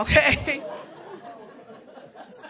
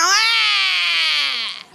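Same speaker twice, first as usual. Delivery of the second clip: disgusted gag cry; 4 semitones higher than usual. Retold or imitated. Spit in mouth, gagging.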